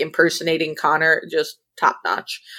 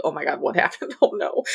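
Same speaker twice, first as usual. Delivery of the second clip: mock terrified; 4 semitones lower, 4 dB quieter, faster than usual.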